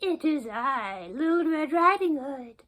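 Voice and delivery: wolf lightly mimicking a sweet girl; wolf faking sweetness. Wolf pretending to be the girl, mock sweet. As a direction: sweeten the voice to fool her. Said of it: "trying to make his voice sound soft and sweet".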